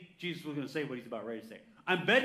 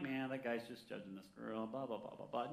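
low, much softer mutter; dismissive inner grumble; 5 semitones lower, 10 dB quieter than usual. Acted. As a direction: mumble it off as a passing thought. Acts out a dismissive private thought.

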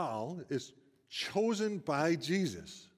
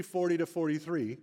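normal talking voice

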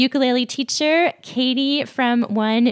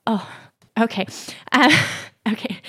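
choking up; much faster than usual. Real, not acted. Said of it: "I'm crying just a little bit".

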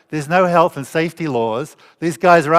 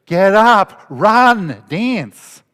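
much louder, higher, a joyful shout; exuberant command; 6 semitones higher, 8 dB louder, much slower than usual. Pretend. Preacher imagining Jesus's hoped-for miracle, exuberant shout. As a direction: bark out a miracle with glee. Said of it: "what are you expecting".

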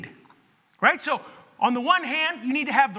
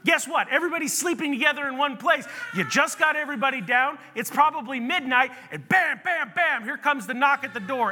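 normal talking voice

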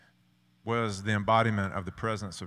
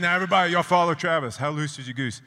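much louder, higher rallying call; coach rallying team; 8 semitones higher, 8 dB louder, faster than usual. Retold or imitated. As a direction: fire up the whole team. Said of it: "and he said".

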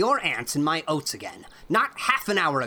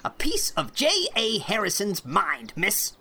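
put-on angry old man; 4 dB louder than usual. A narrator's reading. Irate farmer scolding Anne over the cow, furious. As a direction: rage at the girl, pounding each word.

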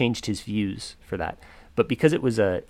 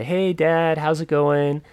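higher, louder, drawn-out greeting to dad; a touch of put-on awkward cheer; staged voicemail confession; 7 semitones higher, 5 dB louder, slower than usual. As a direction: re-enact a careful phone message opener. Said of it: "making it sound like, um, I'm admitting".

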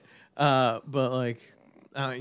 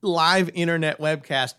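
ordinary delivery

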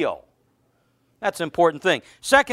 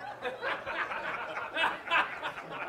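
breathy laugh; 9 semitones higher, 13 dB quieter than usual. Real, not acted. Pastor laughs at own joke.

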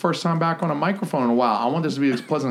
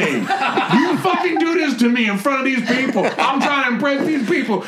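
very high, strained comic indignation; hammed-up squealing protest at being humiliated; mock outraged rant; 13 semitones higher than usual. Host accuses co-host of turning his joke on him, mock outraged.

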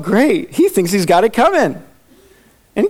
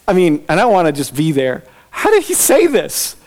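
usual speaking voice

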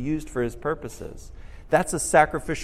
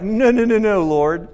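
protesting no; 5 semitones higher, 7 dB louder than usual. Retold or imitated. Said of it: "Peter's like".

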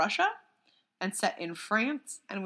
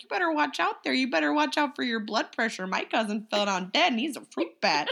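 a light Minnesota lilt, lifted a little; Fargo accent; 4 semitones higher than usual. Retold or imitated. Retelling the bat's line to a friend, in a light Fargo accent. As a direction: quote the bat's warning in a folksy Fargo accent. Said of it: "And he's like".

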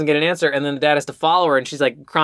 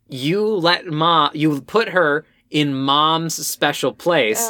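slow quote; much slower than usual. Retold or imitated. Quoting line slowly, stressing it.

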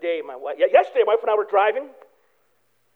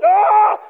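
a high loud thrill yell; 9 semitones higher, 7 dB louder than usual. Retold or imitated. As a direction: cry out like a fighter pilot in a steep turn. Hard turn, Maverick.

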